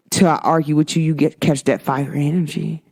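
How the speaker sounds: ordinary talk, nothing marked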